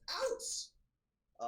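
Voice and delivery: sudden high screech from behind the shelves. Acted. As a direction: shriek it out at the intruders. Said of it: "just an absolute screech".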